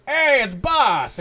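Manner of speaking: loud, high, drawn-out greeting; excited shout. Acted. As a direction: pit droid hollering happily at the boss. Droid excitedly greets its boss.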